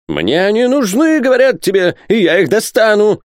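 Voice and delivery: high, loud insistent yell; shouting. A narrator's reading. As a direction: shout the threat at a high pitch. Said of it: "кричал Пальмирен Розет".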